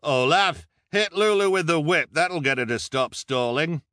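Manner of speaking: loud, high crowd yell; heckler's shout. A narrator's reading. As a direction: egg him on from the crowd. Crowd member yells demands.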